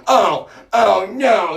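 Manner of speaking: high, loud, horrified mock shriek; loud, high hammed-up gasp of horror; mock shocked relatives. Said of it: "and they're like".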